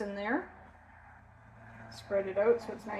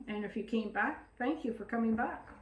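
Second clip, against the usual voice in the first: ordinary talk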